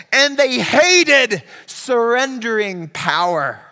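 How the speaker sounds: high, loud, impassioned pulpit shout; thundering sermon climax, pitch soaring; full-force preaching. Real, not acted. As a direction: preach it at full volume, drawing out key words.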